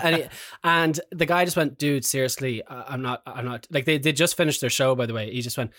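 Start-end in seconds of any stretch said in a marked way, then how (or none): 1.8-3.7 soft, lower-pitched deadpan; quietly unimpressed; weary brush-off. Retold or imitated. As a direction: imitate a tired musician cutting off a fan. Weary band member's reply, retold.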